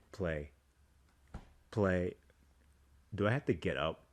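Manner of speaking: usual speaking voice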